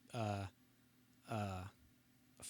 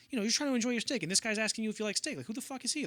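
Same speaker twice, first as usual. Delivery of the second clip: higher, fast coaching voice; mock Scorsese, pitched up and quick; Scorsese directing; 9 semitones higher, faster than usual.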